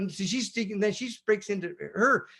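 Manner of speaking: ordinary talk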